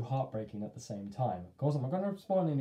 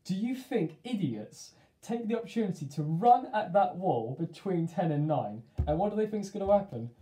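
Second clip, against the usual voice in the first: exasperated mum; 7 semitones higher, 6 dB louder than usual. Retold or imitated. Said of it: "my mum always says to me".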